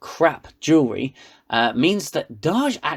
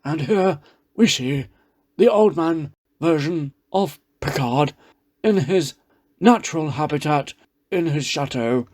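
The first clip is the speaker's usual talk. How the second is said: lightly voiced hushed nature-documentary narrator; a hint of a wildlife-film presenter; mild hushed documentary narration; David Attenborough. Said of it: "not sounding like David Attenborough".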